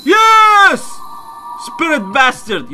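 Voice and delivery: very high, loud cheer; real elated, relieved scream; triumphant yell. Real, not acted. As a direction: shout in victory at last. Finally hit the spirit.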